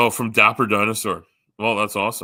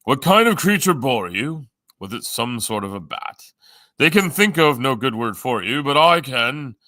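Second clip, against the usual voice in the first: louder, higher-pitched verse recitation; lilting, projected poem delivery; poem recital; 4 semitones higher, 6 dB louder than usual. Acted. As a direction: declaim it like a silly rhyme. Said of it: "there's a poem that I will recite".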